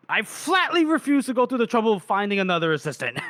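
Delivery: high, quick, huffy mock declaration; indignant refusal. He answers why he won't leave her, indignant refusal.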